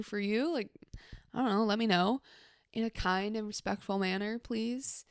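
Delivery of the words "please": normal talking voice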